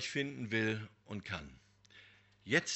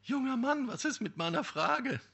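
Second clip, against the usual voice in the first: much higher woman's voice calling out; young woman calling; 12 semitones higher than usual. Acted. Young woman calls out to the passing man, high-pitched. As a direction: call after him in a high voice. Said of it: "rief sie".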